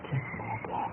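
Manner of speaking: breathless whisper, almost no voice; whispered plea. Acted. Temptress whispering to the married man, no voice at all. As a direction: breathe it right against his ear. Seductress whispers after kiss.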